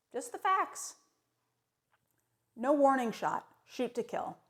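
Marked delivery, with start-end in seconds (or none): 0.1-0.9 much higher, rising on "facts"; higher take. Shown as a demonstration. Second take of the motto, pitched up with a rise. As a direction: lift "facts" up in a bright rise.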